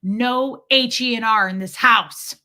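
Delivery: imitating dad's loud, slow house rule; retold angry decree, each word weighted; flipping out. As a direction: lay down the law as an angry dad, loud and drawn out. Dad flipping out at crying.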